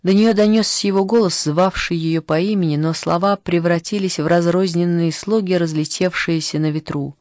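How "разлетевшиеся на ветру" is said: ordinary delivery, nothing marked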